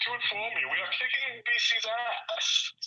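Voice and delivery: imitating dad in a British accent. Dad barging in, imitated in a British accent. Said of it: "in a British accent".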